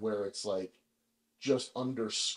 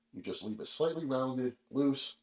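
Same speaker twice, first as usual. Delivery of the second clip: usual speaking voice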